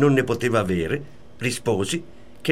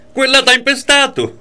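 much higher and louder, mocking her accusation; retold shrill accusation, louder; imitated jealous shout; 12 semitones higher, 6 dB louder than usual. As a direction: spit it out like the jealous wife. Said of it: "diceva sempre".

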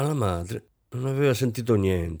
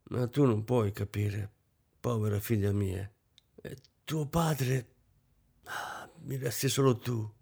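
sad lament; 6 dB quieter than usual. Acted. Mother's tearful lament.

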